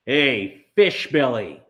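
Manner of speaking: loud taunting call down the street; bully calling out. Acted. Bully Mars Bar hollers at Maniac, loud taunting call. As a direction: yell it to stop him in his tracks.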